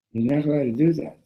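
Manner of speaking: high, loud acted prohibition; insistent objection. Young rabbi to the drinkers, loudly insisting. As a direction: insist that it is forbidden.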